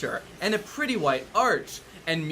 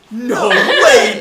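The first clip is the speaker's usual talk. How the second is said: loud, laughing no way; mock amazement; 4 semitones higher, 13 dB louder than usual. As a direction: ham up the author's shock. Mocking author's surprise.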